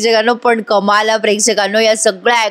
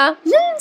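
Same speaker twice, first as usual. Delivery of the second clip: a knowing little hum; a sly hum with the pitch shooting way up; amused hum; slower than usual. Real, not acted. Narrator reacts to the scene.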